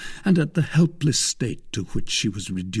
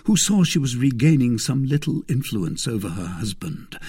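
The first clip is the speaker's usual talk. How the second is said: ordinary delivery, nothing marked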